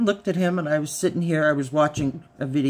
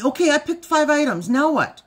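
eager husband; 12 semitones higher, 5 dB louder than usual. Retold or imitated. Husband voiced, high.

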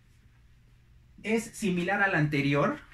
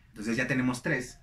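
ordinary delivery

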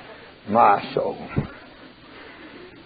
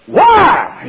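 mad why; 8 semitones higher than usual. Retold or imitated. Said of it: "He was mad".

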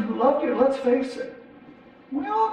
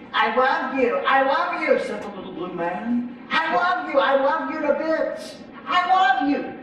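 high, sing-song little creature voice, much higher; little blue man; 5 semitones higher than usual. Acted. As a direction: coo it like a clingy little creature.

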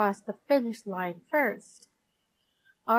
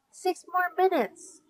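light storybook yell, pitched up; yelled warning; 7 semitones higher than usual. A narrator's reading. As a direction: call out the time across a yard. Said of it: "she yelled".

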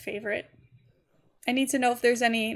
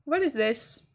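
normal talking voice

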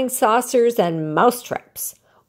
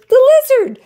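excited shout; 8 dB louder than usual. A narrator's reading. Alexander realises the lizard's magic.

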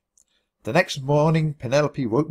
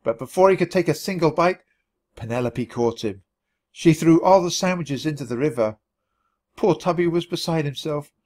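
plain reading voice

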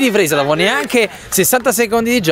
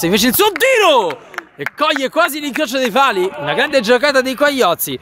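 excited commentary; 7 semitones higher than usual. Real, not acted. Near miss commentary.

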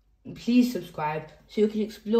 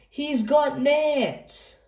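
much higher, slow put-on voice; hammed-up salon voice; 7 semitones higher, much slower than usual. Reader voicing a kid playing hairdresser, slow hammed-up voice. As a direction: drag it out like a fussy hairdresser.

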